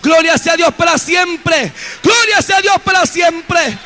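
loud, high praise shout to the congregation; preaching at full force. Acted. Preacher shouting praise to his congregation, fervent. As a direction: lift it up, proclaim God's glory.

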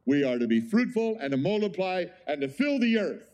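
preaching. Acted. Preacher proclaims the command.

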